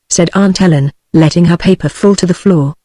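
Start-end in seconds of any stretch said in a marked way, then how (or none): none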